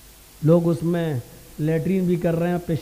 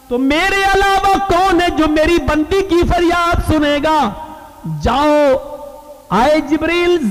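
raised, commanding oratory; full-force preaching; 8 semitones higher, 6 dB louder than usual. Acted. Preacher voicing Allah sending Jibril to earth, full-force preaching. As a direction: proclaim the divine order to the congregation.

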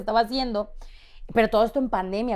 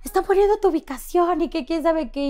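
higher, panicked mother; hurried worried warning; lightly voiced scared mom on the phone; mild terrified mom; 8 semitones higher, 4 dB louder than usual. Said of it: "mi mamá me marca aterrada".

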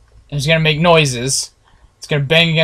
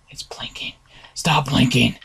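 scared whisper; frightened hush; 6 semitones lower, 7 dB quieter than usual. Real, not acted. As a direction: breathe the words out in fright.

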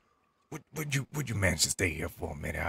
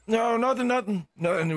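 flustered; 5 dB louder than usual. Real, not acted. Flustered cover-up to character.

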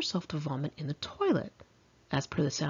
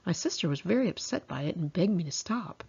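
usual speaking voice

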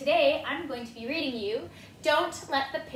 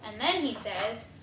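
usual speaking voice

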